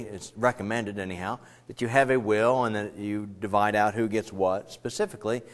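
usual speaking voice

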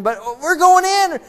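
loud, high, giddy whoop; excited cheer. Retold or imitated. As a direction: cheer like an eager crowd at the border. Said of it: "they're really excited".

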